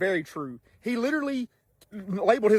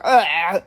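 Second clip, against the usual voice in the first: louder imitated choking yelp; strangled choking cry; 8 dB louder than usual. Salesman lifted off the ground by his throat, imitated choking cry. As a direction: mimic being throttled by the neck. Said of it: "around the front of my throat".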